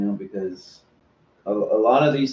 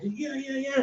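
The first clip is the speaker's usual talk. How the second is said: high, hyped-up yeah yeah yeah; mock psyched cheer; 12 semitones higher, 7 dB quieter than usual. Performer psyched on stage, imitated in a high hype voice. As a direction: pump yourself up before going on.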